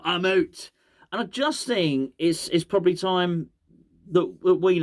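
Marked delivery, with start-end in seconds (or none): 0.0-0.7 higher-pitched mock Scottish impression; hammed-up Scottish catchphrase; Scottish accent. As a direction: put on a Scottish investor's sign-off. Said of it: "I seem to remember him saying".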